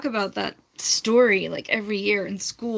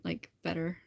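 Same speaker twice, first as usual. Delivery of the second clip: normal talking voice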